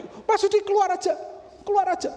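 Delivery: high, loud mock order to get out; Retno. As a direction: throw the husband out in a high-pitched shout. (bentakan tinggi mengusir suami)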